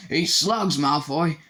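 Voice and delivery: loud, hot retort; loud angry retort at the bully. A narrator's reading. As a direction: Ron snaps back at Malfoy in anger. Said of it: "said Ron angrily".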